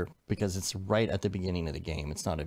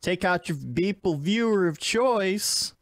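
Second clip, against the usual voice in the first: announcer intro; 5 semitones higher than usual. Acted. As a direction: announce the segment like a show host.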